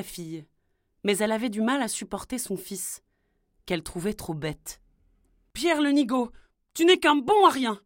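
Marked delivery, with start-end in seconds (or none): 5.5-7.8 voix plus aiguë et forte, méprisante; crie-lui dessus comme une mère exaspérée; mère qui gronde. Acted. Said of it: "lui répétait-elle toute la journée". (mère agacée qui rabaisse son fils)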